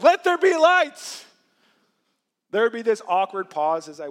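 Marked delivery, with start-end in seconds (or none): 0.0-1.3 high, loud, slow booming decree; grand booming voice of creation; mock divine command. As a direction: proclaim it like God on high. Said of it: "I said".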